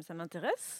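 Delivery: ordinary talk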